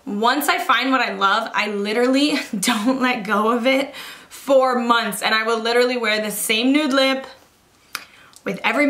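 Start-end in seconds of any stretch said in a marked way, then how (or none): none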